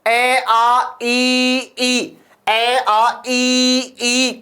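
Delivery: "ए, आ, ई, ई" held long and evenly, one by one; vowel pronunciation demo. Shown as a demonstration. Teacher has students say vowels together, "ए, आ, ई, ई" drawn out. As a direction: chant out "ए, आ, ई, ई" as sustained separate vowels. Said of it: "ई को साथ बोलो".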